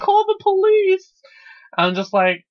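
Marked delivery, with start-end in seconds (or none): 0.0-1.1 high, loud shriek for help; mock screaming. Old lady calling for police, mock scream. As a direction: scream it like a hammed-up victim. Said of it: "starts screaming".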